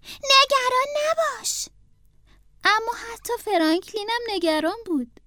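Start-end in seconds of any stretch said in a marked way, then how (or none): 0.0-1.7 higher, slow brave comfort; brave reassurance. Acted. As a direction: sound brave though scared. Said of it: "با شجاعت گفت".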